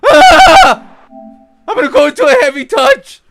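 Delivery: shouted, very high voice breaking into a laugh; hammed-up screaming footballer who can't cope; mock Messi panic. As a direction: shriek like a panicking striker, then gabble fast. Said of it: "And Messi's like".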